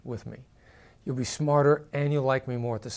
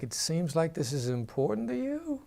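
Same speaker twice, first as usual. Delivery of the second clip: tune rising toward the end, softer; upward inflection; 3 semitones higher, 4 dB quieter than usual. Shown as a demonstration. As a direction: lift the end like an encouraging question. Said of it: "And I'll inflect up".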